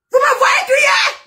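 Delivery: high, stretched-out yell; cri de démonstration. Shown as a demonstration. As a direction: shout the question high and slow. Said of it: "les deux intonations". (question criée, très aiguë)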